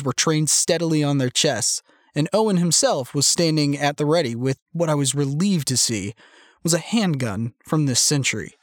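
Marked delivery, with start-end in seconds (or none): none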